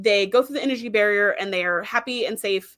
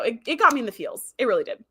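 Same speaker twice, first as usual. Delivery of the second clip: ordinary talk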